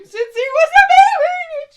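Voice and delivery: high, loud wailing sob. Acted. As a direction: wail it out in full grief. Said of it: "She starts wailing again".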